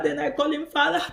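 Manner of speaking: ordinary delivery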